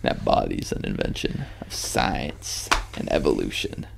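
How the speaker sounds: hushed aside; under the breath; whispered. Real, not acted.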